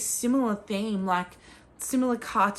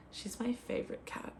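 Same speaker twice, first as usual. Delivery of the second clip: soft aside; 2 semitones higher, 12 dB quieter than usual. Real, not acted. Aside about her cat.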